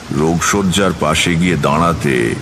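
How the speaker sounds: ordinary talk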